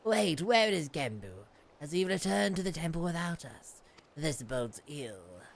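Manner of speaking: low, grave character voice; deep, measured dramatic read; solemn game character. Acted. Reads game character's dialogue.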